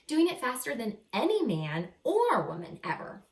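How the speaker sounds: bright, lively picture-book reading voice; animated first-person story narration; reading to children. A narrator's reading. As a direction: read it like a storybook to young kids.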